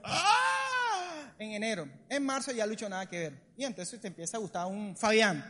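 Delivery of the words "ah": high-pitched drawn-out "aah"; put-on crush cry; mock swooning squeal. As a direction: squeal like a lovestruck teen. Acting out girl swooning over Lucho.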